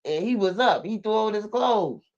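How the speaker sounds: a hint of the mother telling her story; woman's voice. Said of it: "she said".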